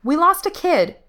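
high, loud urgent retort; sharp, distressed snap; snapped back. A narrator's reading. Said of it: "she snapped back".

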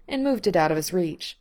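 ordinary delivery, nothing marked

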